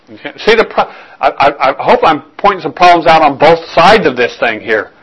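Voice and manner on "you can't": "You can't." much softer, almost under the breath; quiet echo. Shown as a demonstration. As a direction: let "You can't." fade away softly.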